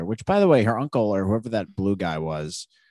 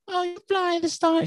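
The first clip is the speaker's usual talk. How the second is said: put-on much higher, childlike play voice; little kid voice. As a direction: pipe it up like a small child at play. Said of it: "little kids talking about".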